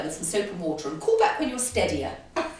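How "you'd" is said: normal talking voice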